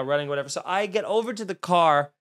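usual speaking voice

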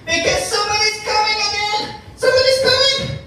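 building to a high, fast alarm; excited warning. Acted. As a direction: cry out that someone's coming. Warning the pigs, rising alarm.

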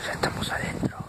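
whispering; hushed whisper while sneaking in; nervous night vlog whisper. Real, not acted. Sneaking into dark house at night.

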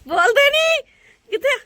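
shrieking in fear; scared shriek. Real, not acted. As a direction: cry out in panic. Scared on high balcony, calling out. (ਡਰ ਨਾਲ ਚੀਕ)

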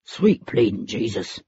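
low gruff man's voice; a gruff, frightened henchman. A narrator's reading. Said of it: "said a man's gruff voice".

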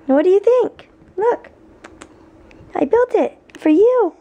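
high, loud sing-song cooing at the puppy; puppy talk. Real, not acted. Owner coos at her new puppy about its crate, high sing-song baby talk.

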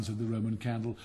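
ordinary delivery, nothing marked

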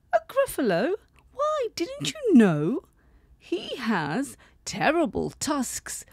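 high, wide-eyed mouse telling a scary tale. Acted. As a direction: squeak it up, spooking the fox. Mouse describes monster.

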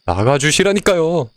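high, drawn-out exasperated plea; insistent demand. Real, not acted. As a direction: stretch it out in annoyance. Insisting the grandpa leave. (짜증 섞인 강한 요구)

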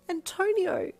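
high, loud squeak of surprise; surprised squeak. A narrator's reading. Passenger recognises the cabbie, squeaking in surprise. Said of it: "you squeaked with surprise".

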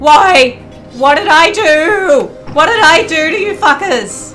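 loud, high wailing yell; panicked protest. Real, not acted. Gamer protesting to attacking enemies, yelling desperately.

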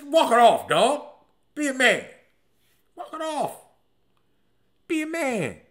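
high, loud tough-love shouting; imitated shout across the field; mock sideline yell. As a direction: yell at a fallen teammate to shake it off. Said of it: "somebody yelled out".

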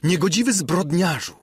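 high, accusing exclamation; outraged cry. A narrator's reading. Princess denounces Ksawery, high outraged cry. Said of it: "zawołała księżna".

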